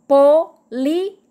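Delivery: "Política" split as po, lí, ti, ca; syllable by syllable. Shown as a demonstration. Teacher counting four syllables, "Política" said in separated syllables. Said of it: "Quatro sílabas".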